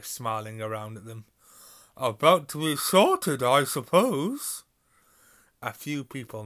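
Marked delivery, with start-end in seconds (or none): 2.0-4.6 loud, slow, cheerful friar; a friendly monk booming kindly; jolly booming friar. Acted. Friar to first years.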